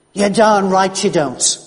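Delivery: a doctor snapping back at his patient. Retold or imitated. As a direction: slam it back at him slowly. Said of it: "His voice was rising like my own".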